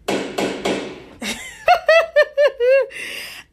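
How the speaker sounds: loud high laugh; big real laugh. Real, not acted. Laughing at a friend.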